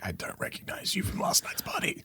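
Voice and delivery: unvoiced whisper to herself. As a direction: hush it like you don't want to be heard on the couch. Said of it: "I'm like".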